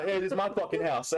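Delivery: cocky home-crowd brag; mock defiant fighter. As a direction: claim your turf, hammed up. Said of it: "good try".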